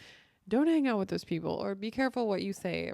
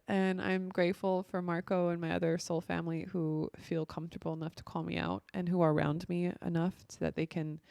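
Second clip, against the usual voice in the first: ordinary delivery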